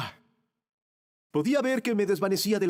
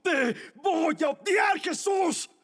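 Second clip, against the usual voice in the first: hateful shout; 3 semitones higher than usual. Acted. Condemned thief cursing Jesus, hateful shout. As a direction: roar out undying hatred word by word.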